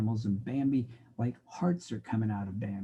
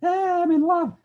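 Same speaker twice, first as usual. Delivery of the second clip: much higher dreamy swooning voice; lovesick student; 8 dB louder than usual. Acted. Skipping student tells the professor he's in love, swooning. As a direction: sigh it out, head in the clouds.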